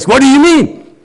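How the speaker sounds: high, loud and pointed; mean challenge. Shown as a demonstration. Teacher showing his mean retort, high and loud. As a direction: snap back with meanness. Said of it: "I would show my meanness".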